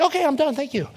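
retold awkward exit. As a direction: blurt it out to escape, then drop off. Retelling his awkward, hurried exit.

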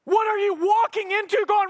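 high, drawn-out preacher's challenge; urgent pulpit appeal; impassioned preaching. Real, not acted. Preaches at full force.

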